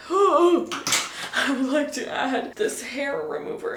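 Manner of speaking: gasp, then slow grand words; mock-grand gasped announcement. Shown as a demonstration. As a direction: announce it like a big revelation. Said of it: "I would like to add the hair remover".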